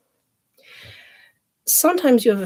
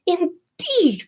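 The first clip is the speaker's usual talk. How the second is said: mother's cry; 10 semitones higher, 4 dB louder than usual. Acted. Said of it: "cried her mother".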